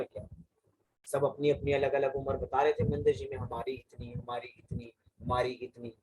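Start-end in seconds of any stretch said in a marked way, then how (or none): none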